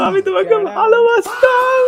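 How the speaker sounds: high, loud, slow wail of fake heartbreak; mock heartbroken cry. Prank caller to the young wife, a hammed-up heartbroken wail. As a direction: ham up the jilted lover.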